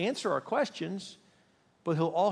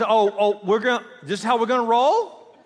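higher, louder comic indignation; God taken aback; 6 semitones higher, 7 dB louder than usual. Acted. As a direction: act affronted and ready to take on the challenger. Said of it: "He says".

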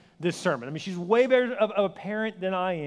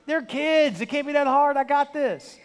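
higher, brushing it all off; cocky dismissal; 6 semitones higher than usual. Retold or imitated. Single guy brushing off the single mom's warning, cocky and higher. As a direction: wave her worries away, too sure of himself. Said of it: "He said".

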